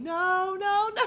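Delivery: high, loud, wailing "no"; imitated yelling. Candace trying to stop the fight, mock yelling. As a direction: wail it out as if begging them to stop. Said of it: "Candace is yelling".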